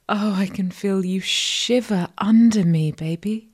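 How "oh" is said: usual speaking voice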